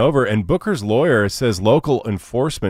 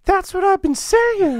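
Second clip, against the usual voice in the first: catchphrase voice; slower than usual. Acted. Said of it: "here's your chance to say".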